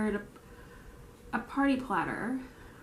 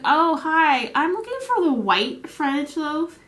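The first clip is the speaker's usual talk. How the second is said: higher, louder put-on customer voice; mock customer; 6 semitones higher, 7 dB louder, slower than usual. Customer asking the bakery for bread, mock-polite and loud. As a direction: imitate an eager customer asking.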